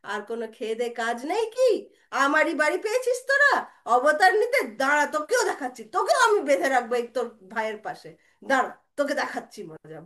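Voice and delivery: loud, rapid motherly tirade; scolding threat. Acted. Mother threatening to tie Balaram too, loud fast scolding. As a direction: threaten the boy, fed up.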